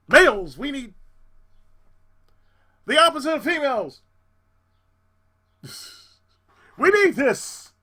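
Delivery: impassioned plea. Real, not acted. Lone tenor begging for men to join the choir, loud plea. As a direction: beg the audience loudly.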